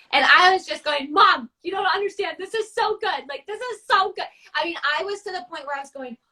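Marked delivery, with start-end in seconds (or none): 1.1-4.3 excited insisting. Retold or imitated. Daughter insisting to her mom the Buddha painting is great, excited. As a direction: rave at mom about how good it is.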